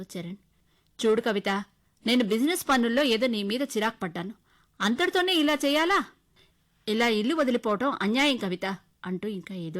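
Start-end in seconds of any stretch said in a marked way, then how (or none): 1.1-8.8 reproachful husband. Acted.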